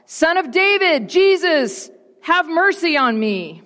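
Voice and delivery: high, pleading call with falling ends; crying out. A narrator's reading. Blind beggar calls to Jesus for mercy, crying out. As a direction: cry out high, begging for attention. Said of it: "he began to cry out".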